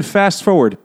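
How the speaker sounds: slow, drawn-out "fast forward". Shown as a demonstration. Joking to co-hosts while skipping ahead, slowed down. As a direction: drag the words out as a joke. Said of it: "but slowly".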